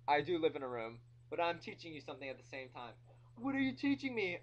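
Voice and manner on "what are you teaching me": high, loud questioning voice; indignant high-pitched interruption; imagined heckler. Acted. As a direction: butt in as a protesting listener. Acts out the listener's objection.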